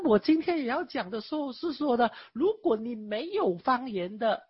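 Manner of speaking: usual speaking voice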